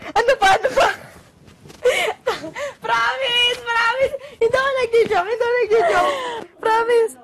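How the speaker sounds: high, loud laughing insistence; excited pleading. Real, not acted. Insisting to her friend she isn't joking, loud and giggly. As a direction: swear it's true through giggles.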